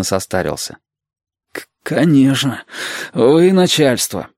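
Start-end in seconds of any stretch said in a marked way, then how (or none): 1.5-4.3 slow, stammered, reluctant assent; sailor's drawn-out, grudging voice; grudging grumble. A narrator's reading. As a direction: give in grumpily, tripping on the first word. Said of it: "буркнул матрос".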